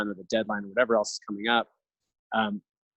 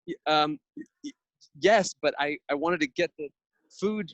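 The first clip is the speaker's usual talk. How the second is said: put-on higher, stammering little kid; child's voice; 6 semitones higher than usual. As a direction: mimic a flustered child who forgot his snack. Dad voicing his stammering child.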